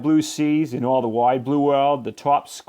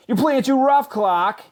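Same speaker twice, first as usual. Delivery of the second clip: high, loud whiny protest; complaining kids; 11 semitones higher, 3 dB louder than usual. A narrator's reading. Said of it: "cried the other kids".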